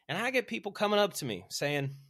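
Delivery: high-pitched Alabama storytelling voice; A L Williams. Retold or imitated. Motivational speaker introducing complainers, Alabama accent. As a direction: set up the tale like an Alabama speaker. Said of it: "very Alabama accent".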